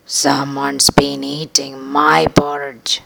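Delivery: slow, lightly voiced mama bear; a touch of a mother bear's surprise; Mama Bear notices her bowl. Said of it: "said the mama bear".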